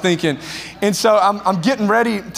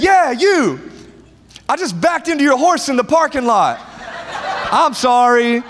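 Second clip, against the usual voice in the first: mocking roast; 5 semitones higher than usual. Teen roasting the big cowboy from the stands, mocking and high. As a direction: taunt him with a grin in your voice.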